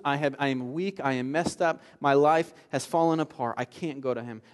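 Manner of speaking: ordinary talk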